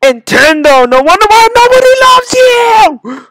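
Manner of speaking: high, loud rant building to a scream; enraged screaming. Real, not acted. Gamer blaming Nintendo, enraged screaming. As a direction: scream abuse at the game maker. Raging at the game maker.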